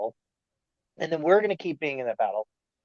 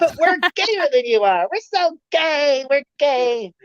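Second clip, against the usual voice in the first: mock boastful America; 11 semitones higher, 4 dB louder than usual. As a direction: show off like a proud child. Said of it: "we're like".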